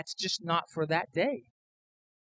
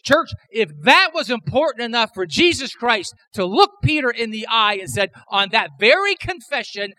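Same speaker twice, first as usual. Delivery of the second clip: louder and higher, hammering each "one"; preaching at full force; 9 semitones higher, 8 dB louder than usual. Acted. Pastor to his church on Peter's confession, impassioned preaching. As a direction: pastor to congregation, driving the point home, building preaching.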